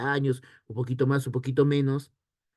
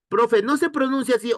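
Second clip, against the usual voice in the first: high, bright-eyed student question, pitched well up and louder; pretend student asking; 10 semitones higher, 4 dB louder than usual. Teacher plays a student asking about pronunciation, in a high eager voice. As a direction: play the pupil cutting in to check the pronunciation.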